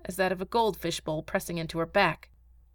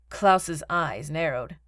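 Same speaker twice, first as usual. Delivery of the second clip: plain reading voice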